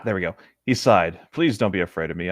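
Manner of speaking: ordinary talk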